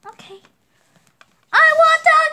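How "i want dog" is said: high, loud demand; whine-yell for the treats. Pretend. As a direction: pet dog yelling at the princess for dog treats.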